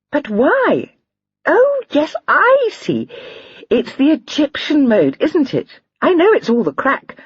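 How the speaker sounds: put-on high, light girlish voice, amused; a lively young girl laughing at a joke; young lady Lydia. A narrator's reading. Lydia's voice read aloud.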